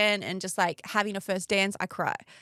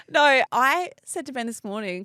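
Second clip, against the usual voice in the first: ordinary talk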